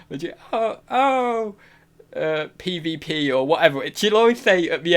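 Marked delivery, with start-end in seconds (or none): 0.5-1.6 high mock "oh, oh"; put-on high voice, imitating her; imitating her giggly reaction. Streamer impersonating a giggler in the chat, high giggly imitation. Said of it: "she just giggle".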